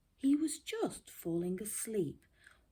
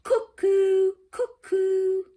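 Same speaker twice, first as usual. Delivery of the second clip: very high, loud cuckoo; cuckoo call; 14 semitones higher, 14 dB louder, slower than usual. Acted. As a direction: sing it out like a cuckoo clock. Cuckoo clock strikes.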